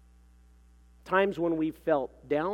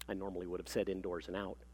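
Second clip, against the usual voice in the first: low, soft, quick undertone; dry aside; 8 semitones lower, 10 dB quieter, faster than usual. A narrator's reading. Mother admits what she really means, dry hushed aside. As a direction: toss it off as a confidential translation. Reader's aside between pompous claims.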